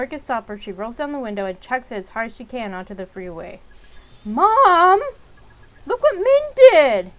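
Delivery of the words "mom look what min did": loud, high, slow shouted tattle; tattling yell. Acted. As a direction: yell for mom to get sister in trouble. Kid yells to tattle on sibling.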